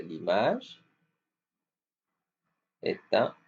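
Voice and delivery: ordinary talk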